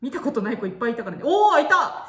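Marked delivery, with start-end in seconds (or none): 1.2-2.1 excited cry. Real, not acted. Finds creature again.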